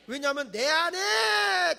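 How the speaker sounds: slow, loud, high call; preaching shout. Real, not acted. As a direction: drag it out as a loud preaching cry. Preacher calls out emphatically. (천천히 크게 외치듯)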